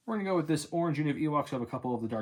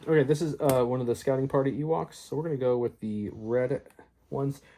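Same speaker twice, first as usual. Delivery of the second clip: ordinary delivery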